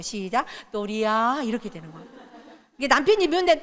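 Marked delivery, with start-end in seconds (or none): 0.7-1.4 soft calm call. Shown as a demonstration. A parent calling 또리야 gently after practicing calm. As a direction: say the name kindly after calming down. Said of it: "아까 마 연습할 때는".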